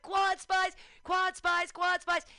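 loud, high repeated holler; calling out. Retold or imitated. Fan searching for the Quad Spies, calling out. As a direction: call out to be found in a crowd.

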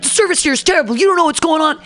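high, heated mock complaint; imitated yelling. Angry customer complaining about the taco service, mock yelling. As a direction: mimic an irate customer lashing out. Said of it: "he starts yelling at Jonathan".

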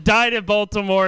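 normal talking voice

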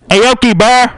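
high loud chant; shout. Acted. Partygoer chanting, loud.

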